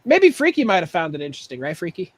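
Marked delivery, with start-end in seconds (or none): none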